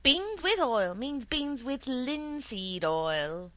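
high, loud, drawn-out correction; exasperated. A narrator's reading. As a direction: spell it out loudly to a child. Exasperated mother correcting son.

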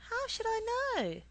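very high-pitched wail of a retort; exasperated protest. A narrator's reading. Boy protesting again to mother.

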